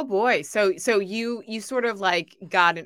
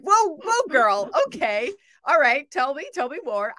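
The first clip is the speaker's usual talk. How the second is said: surprised; 7 semitones higher, 7 dB louder than usual. Retold or imitated. Retold reaction to newsletter.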